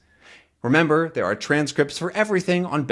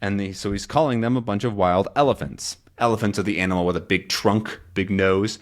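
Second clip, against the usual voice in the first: normal talking voice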